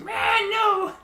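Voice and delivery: high squeaky yelp, way up; garbly kobold voice. Acted. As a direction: yap it out like a tiny kobold. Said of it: "my garbly kobold voice".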